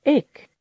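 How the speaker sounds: إك said alone and loud, high and clipped; letter sound. Shown as a demonstration. Teacher modelling the letter sound إك for pupils. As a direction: model the sound إك for the class to repeat. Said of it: "رددوا معي".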